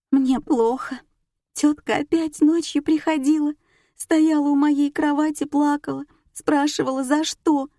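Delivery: high-pitched, tearful confession; distraught. A narrator's reading. Nika tells of her dead aunt's night visits, distraught. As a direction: plead in a high, haunted voice.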